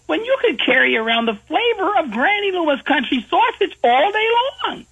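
high, sweet cartoon granny pitching sausage; high-pitched hammed-up granny ad voice; old granny voice. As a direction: sell it like a folksy grandma, way up high. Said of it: "She says".

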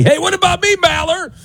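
high-pitched mock heckle; imitated listener yelling. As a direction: pipe up like a neglected fan demanding a mention. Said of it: "they're yelling at me".

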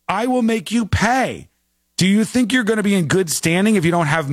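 hammed-up boss's threat; mock threat. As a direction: threaten him like a ruthless boss. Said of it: "he claims this woman said".

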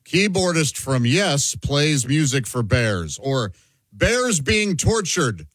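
each word spaced out like a headline; reading out mock headlines slowly to make a point. Shown as a demonstration. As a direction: read the two headlines slowly, weighing them. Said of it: "You have two headlines side by side".

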